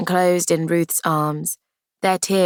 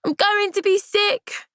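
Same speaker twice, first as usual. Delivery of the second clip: put-on wailing child. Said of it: "crying and wails of".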